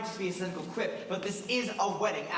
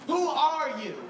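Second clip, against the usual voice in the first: Who are you said again, higher and louder; louder, higher retake; 8 semitones higher, 6 dB louder, slower than usual. Retold or imitated. Husband staring at his wife in bed, rising alarm. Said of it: "you think to yourself".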